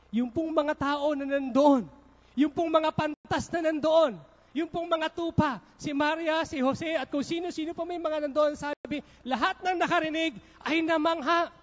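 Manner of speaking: rising sermon fervor; preaching build. Acted. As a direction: build it up to the crowd. Preaching climax on the nativity.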